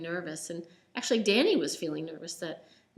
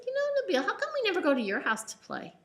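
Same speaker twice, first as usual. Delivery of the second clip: put-on child voice; 12 semitones higher, faster than usual.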